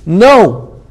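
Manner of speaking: one firm, emphatic NO; emphatic refusal. Retold or imitated. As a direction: retell "No" as a forceful, final refusal. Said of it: "the Imam said emphatically".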